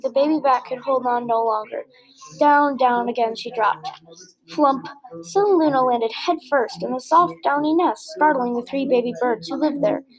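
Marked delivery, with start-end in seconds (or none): none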